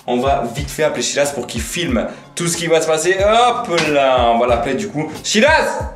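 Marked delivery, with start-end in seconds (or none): 5.2-5.9 loud call down the hall. Real, not acted. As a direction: shout his name to another room. Calling brother from another room.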